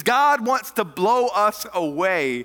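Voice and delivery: loud, high, ringing sermon climax; full-force preaching. Acted. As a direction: preach it out to the back rows.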